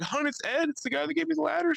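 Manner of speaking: high-pitched, agitated explaining; mock urgent excitement. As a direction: replay pleading for your partner's attention. Retells excited news to sleepy partner.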